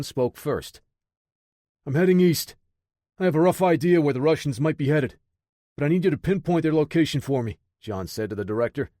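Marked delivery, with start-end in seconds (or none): 1.9-7.6 urgent. Acted. Urgent call while speeding.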